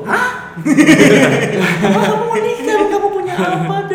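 high, slow, stunned disbelief; shocked parent. Retold or imitated. Parents' shocked reaction, retold.

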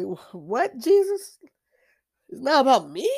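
mock-hurt surprise at being told off; incredulous surprise. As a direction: protest in startled disbelief.

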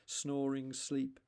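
ordinary delivery

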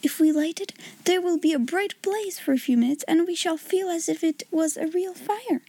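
high, eager make-believe plan; excited exclamation. A narrator's reading. Sarah planning a pretend fire, excited exclamation. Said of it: "she exclaimed".

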